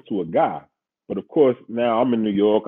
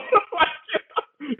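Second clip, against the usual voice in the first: quick laugh, much higher. Real, not acted. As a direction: laugh high at the memory. Laughing at his own story.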